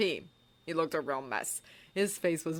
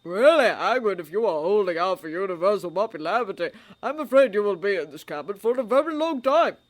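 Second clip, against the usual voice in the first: put-on louder, stern old man; stern old wizard; 2 semitones higher, 8 dB louder than usual. A narrator's reading. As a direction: chide him firmly. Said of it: "peering sternly".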